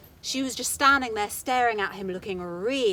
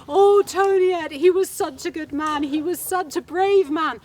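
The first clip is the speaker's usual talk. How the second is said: high, loud hammed-up lament; relatives mourning; 7 semitones higher, 8 dB louder than usual. Relatives pretending to mourn Toniet, high loud wailing. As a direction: wail his praises like mourners. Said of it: "came in crying, weeping".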